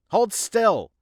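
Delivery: sharp high shout; shouted order. Acted. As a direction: bark the order loudly. Shouting at patient.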